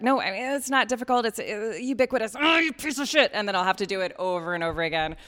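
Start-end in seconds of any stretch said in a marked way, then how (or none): 0.0-2.4 higher-pitched mock fumbling for words; floundering improvised intro. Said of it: "if I just kinda improvise". 2.4-3.3 high, loud burst of mock frustration; mock self-berating. Mock self-berating over a botched intro.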